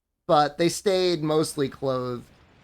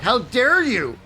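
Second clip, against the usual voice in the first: high, hammed-up outrage; mock offended fan, stretched out; indignant; 10 semitones higher, slower than usual. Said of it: "somebody got pissed".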